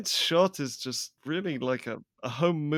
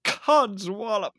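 slow, high, louder exclamation; relished exclamation; 7 semitones higher, 3 dB louder, slower than usual. Pretend. Cohost savouring a favourite old-fashioned word, relishing exclamation. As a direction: draw it out, pitched up with relish.

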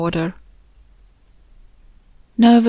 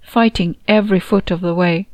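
usual speaking voice

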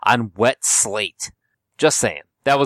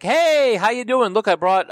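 cheery high-pitched hello; excited greeting; 11 semitones higher than usual. Retold or imitated. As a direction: greet like an eager friend. Friends on the train greeting.

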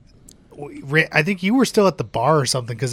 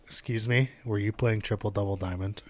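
hushed pit boss; 6 semitones lower, 13 dB quieter than usual. Retold or imitated. Pit boss voiced in retold story.

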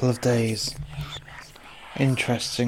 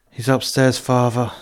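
ordinary talk, nothing marked

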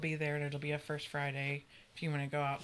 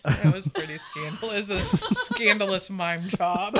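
laughing; 3 semitones higher, 10 dB louder than usual. Real, not acted. Laughing about scandalous mime.

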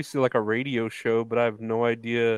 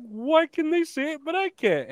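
much higher whiny mock Hogan voice. Host parodies Hogan's complaint for cohost, much higher whine. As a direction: squeak out his complaint as a parody. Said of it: "And it's like".

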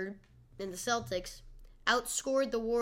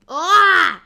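loud startled yelp, laughing; 9 semitones higher, 20 dB louder than usual. Real, not acted. Startled by hiccup.

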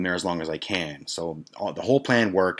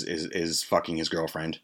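ordinary talk, nothing marked

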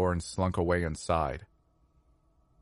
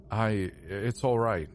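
slow, halting reassurance; stammering; 2 semitones higher, slower than usual. A narrator's reading. Shaken passenger reassures the apologetic stewardess, stammering. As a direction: falter on the first word, then slowly reassure. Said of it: "I stammered".

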